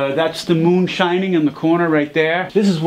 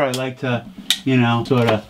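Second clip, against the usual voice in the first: ordinary talk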